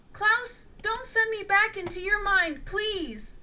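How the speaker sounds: high, begging plea; pleading. A narrator's reading. The killer begging Klaus to spare him, pleading. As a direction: beg not to be sent away. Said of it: "pleaded the killer".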